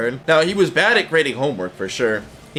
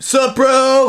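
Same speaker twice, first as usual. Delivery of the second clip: cool bro greeting; 11 semitones higher, 6 dB louder than usual. Retold or imitated. Teacher greets former student.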